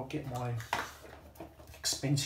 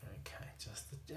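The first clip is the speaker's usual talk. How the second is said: whispered, much softer; concentrating whisper; 2 semitones lower, 15 dB quieter than usual. Real, not acted. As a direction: whisper while focusing on a tiny task. Concentrating on delicate work.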